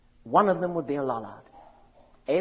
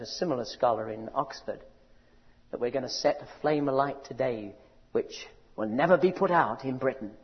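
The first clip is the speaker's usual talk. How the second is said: ordinary delivery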